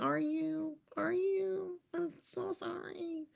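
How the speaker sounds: high, soft, sarcastic fake pity; mother mocking her sore son with soft fake pity; mock sympathy. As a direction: coo it sweetly then deadpan no one cares. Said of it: "I'm like".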